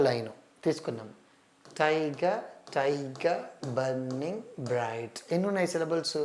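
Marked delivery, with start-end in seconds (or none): 1.8-5.2 slow, drawn-out recital counting each syllable; syllable count reading. Shown as a demonstration. Teacher counting syllables of a children's poem, slow and drawn out. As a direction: space every syllable out evenly. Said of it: "one, two, three".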